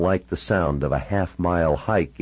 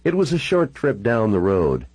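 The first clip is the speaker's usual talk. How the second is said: ordinary delivery, nothing marked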